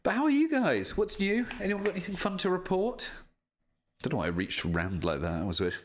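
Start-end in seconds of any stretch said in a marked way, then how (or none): 0.0-3.1 high, bright streamer thanks; high, perky chat-reading voice; bubbly chat thanks. Real, not acted. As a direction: thank them with a lift.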